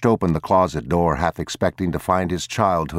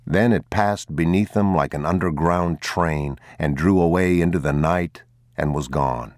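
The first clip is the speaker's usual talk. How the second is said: ordinary delivery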